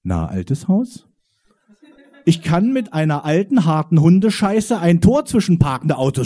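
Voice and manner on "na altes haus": put-on slow, lower, knowing greeting to an old house; conspiratorial greeting. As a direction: greet it like a secret accomplice, drawn out. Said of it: "mit einem verschwörerischen Augenzwinkern". (verschwörerisch langsam, tiefer und vertraulich)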